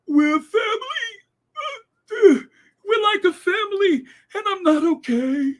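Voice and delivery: high, wailing fake-sob voice; mocking Allison's crying. Mocking Allison's TV plea in a high fake-sob voice. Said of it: "with all them crocodile tears".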